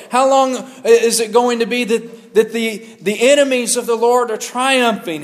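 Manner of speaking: high, pleading cry to God. Retold or imitated. Pastor voicing the psalmist crying out to God, anguished. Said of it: "who's crying out and saying".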